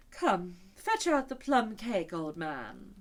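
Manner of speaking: put-on drawn-out order to an old man; unicorn's demand. A narrator's reading. Unicorn ordering the king to fetch cake, slow and bossy.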